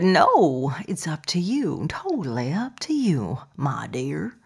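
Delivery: attempted Southern accent. Host quotes her Southern guest's answer, attempted Southern accent. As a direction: have a go at a Southern accent, not very well. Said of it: "do a good accent".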